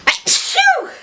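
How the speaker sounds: loud high achoo sneeze; sneezing. Real, not acted. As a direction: let a big sneeze out. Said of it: "I usually do the achoo noise".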